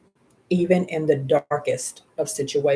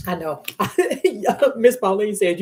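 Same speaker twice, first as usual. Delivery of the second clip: ordinary talk, nothing marked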